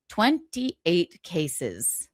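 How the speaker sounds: drawn-out, slow, incredulous; slow disbelief. Real, not acted. Host repeating the lawyer's case count, slow and incredulous. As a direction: let each word hang in disbelief.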